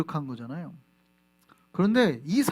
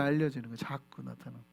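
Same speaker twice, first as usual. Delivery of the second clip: soft echo; 6 semitones lower, 8 dB quieter than usual. Shown as a demonstration. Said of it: "알려지는 거예요".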